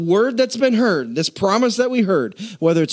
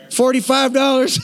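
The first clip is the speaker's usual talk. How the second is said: laughing; 7 semitones higher than usual. Real, not acted. Haggling over pretend cow price.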